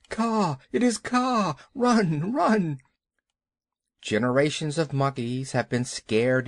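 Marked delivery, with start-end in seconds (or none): 0.1-2.8 high-pitched monkey alarm cries; panicked cries. A narrator's reading. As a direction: cry out the alarm in a raised pitch. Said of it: "monkeys scattered with cries of".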